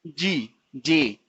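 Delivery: जी and जे stretched very slowly, sound by sound; sounded-out letters. Shown as a demonstration. Teacher sounding out the letters of एज, very slow. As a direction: spell out जे slowly. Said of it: "पहले तो बना".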